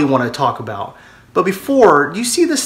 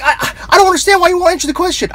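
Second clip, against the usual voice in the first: higher, fast, stammering frustration; exasperated radio host; 11 semitones higher, much faster than usual. Retold or imitated. Host exasperated at a guest who won't answer, sputtering. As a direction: sputter like a host losing patience.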